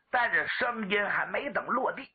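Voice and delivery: usual speaking voice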